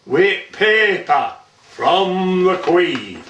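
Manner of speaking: measured, rhythmic dialect monologue; poem recitation. Acted. Man recites an old Burton tale to an audience, theatrical poem recitation.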